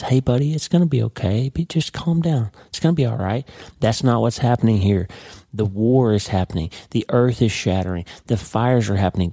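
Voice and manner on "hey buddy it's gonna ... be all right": higher-pitched, coaxing comfort voice; soothing parent. Retold or imitated. Pastor showing how they soothed their stressed kids, high and coaxing. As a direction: imitate soothing a stressed child. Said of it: "Like I used to talk to Mitch when he was stressed".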